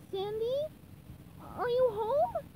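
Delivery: very high, tentative calling; high searching cartoon voice; SpongeBob. A narrator's reading. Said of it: "SpongeBob called".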